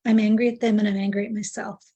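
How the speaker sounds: ordinary talk, nothing marked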